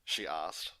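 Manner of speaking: usual speaking voice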